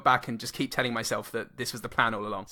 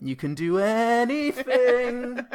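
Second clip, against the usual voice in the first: very slow, higher epic line; grand slow declaration; 10 semitones higher, much slower than usual. Acted. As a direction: stretch it out like a movie trailer.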